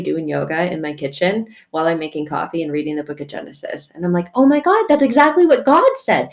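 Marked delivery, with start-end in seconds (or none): 4.3-6.3 high-pitched excited realisation; retold amazement. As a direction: exclaim it as a thrilled discovery. Retelling own astonished reaction.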